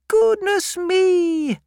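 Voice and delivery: very high, slow gasp of surprise; an old lady taken aback; astonished old aunt. Acted. Aunt Betsy sees how young the widow is, very high and amazed.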